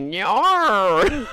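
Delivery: a slow, drawn-out Australian "no"; mock Australian "no", drawn out and rising. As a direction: stretch out "no" with a rising Australian twang. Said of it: "I hate the way, like, Australians say".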